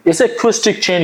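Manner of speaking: ordinary delivery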